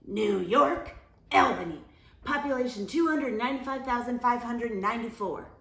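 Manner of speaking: loud, fast know-it-all blurt; loud rapid interruption; interrupting. A narrator's reading. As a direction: cut in and rattle it off. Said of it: "Camilla interrupted".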